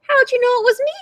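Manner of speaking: high, hammed-up turkey dismay; mock groan. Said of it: "groaned Turkey".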